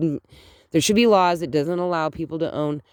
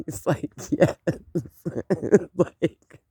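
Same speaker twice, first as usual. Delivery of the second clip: softer, words breaking up in laughter; laughing; 3 dB quieter, slower than usual. Real, not acted. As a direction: giggle through the retelling. Laughing while retelling shared disappointment.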